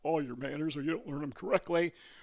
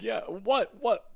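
startled what; 5 semitones higher, 3 dB louder, much slower than usual. Retold or imitated. Reacting to the interrogation.

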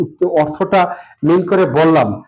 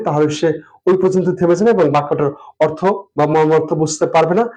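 ordinary delivery

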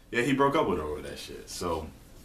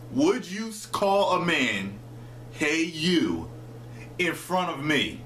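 slow, higher-pitched pointed question; pointed challenge; 6 semitones higher, slower than usual. Retold or imitated. Retelling the challenge to Sarah on the phone, slow and pointed. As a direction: draw the question out to make the point.

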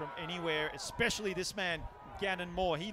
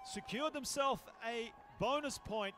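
higher-pitched, measured commentator delivery; announcer throw to break; 5 semitones higher than usual. Real, not acted. Commentator tells viewers they will be back after break, announcer voice.

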